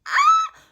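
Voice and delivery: short, very high loud shriek; hammed-up piercing yelp; mock shocked scream. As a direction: fake a sudden scream of amazement.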